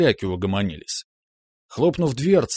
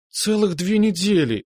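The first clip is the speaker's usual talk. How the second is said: suffering whine; 9 semitones higher than usual. A narrator's reading. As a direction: drag out недели like torture. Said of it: "страдающим голосом затянула Настя".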